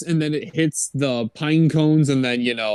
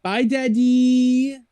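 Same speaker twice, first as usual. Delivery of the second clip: put-on high, slow little-boy farewell; childlike goodbye; 9 semitones higher, slower than usual. Pinocchio saying goodbye to Daddy Derek, high childlike. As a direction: sing it out like a small kid. Pinocchio leaving his father.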